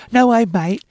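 a hint of an impersonated rock singer. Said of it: "just imagine it's Robert going".

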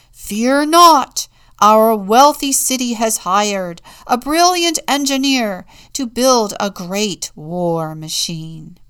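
very loud, high boasting; boastful shout. A narrator's reading. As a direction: trumpet it out proudly. Leaders boast a third time.

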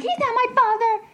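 high-pitched, stubborn retold protest; defiant child yell. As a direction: re-enact a kid shrieking back at mom. Child screaming back, retold.

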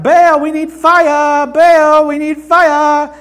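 very loud, high, drawn-out droning wail; hammed-up desperate chant, belted on one note; mock crying out. Baal's prophets calling to their silent god, crying out. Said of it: "crying out".

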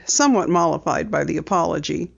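ordinary delivery, nothing marked

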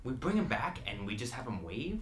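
ordinary delivery

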